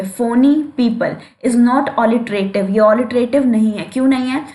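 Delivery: normal talking voice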